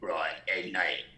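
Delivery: low, soft false-vocal-fold growl; laryngeal voice. Shown as a demonstration. Demonstrating false vocal folds, laryngeal voice. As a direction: drop down into the throat on false folds. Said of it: "which is like your laryngeal voice".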